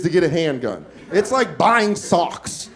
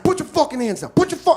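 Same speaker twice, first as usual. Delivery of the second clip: higher-pitched mock angry order; retold fury, pitched up without shouting; furious robber; 8 semitones higher, faster than usual. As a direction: imitate a robber's frantic command. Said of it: "this guy's furious that my hands are up".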